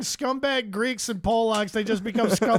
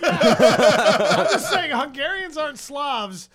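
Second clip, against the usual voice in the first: laughing; 5 semitones higher, 7 dB louder, much slower than usual. Real, not acted. Laughing through his point.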